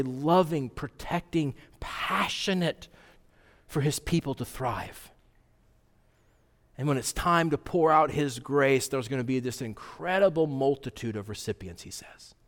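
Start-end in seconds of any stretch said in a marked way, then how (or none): 0.0-5.1 soft fervent hush. Real, not acted. Sermon winds down softly. 11.8-12.4 whispered aside. Real, not acted. Trailing aside.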